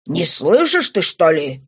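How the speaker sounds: mild angry cry from a father; shouting. Acted. Father scolds his hesitating daughter, understated shout. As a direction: demand it sharply, a restrained shout. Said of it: "закричал отец".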